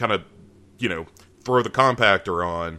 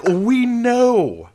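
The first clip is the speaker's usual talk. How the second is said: exasperated; 8 semitones higher, slower than usual. Retold or imitated. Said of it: "you're like".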